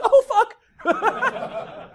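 high, loud, laughing mock panic; mock panicked. Guy caught looking at a cute girl, laughing panic. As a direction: yelp it like you got caught staring. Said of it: "we're like".

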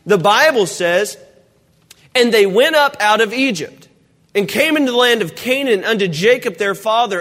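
usual speaking voice

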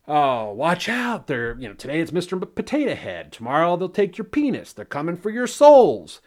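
mock alarmist pundit, rising to loud; mocking news blowhard. Mocking cable news pundits warning viewers, mock alarm building loud. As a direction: ham up a fearmongering TV blowhard. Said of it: "every other blowhard out there on the news".

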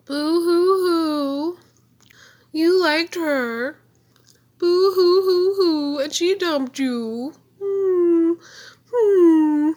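high, slow mock sobbing; boo-hoo taunt, whiny and loud; fake crying. Taunting heartbroken character.

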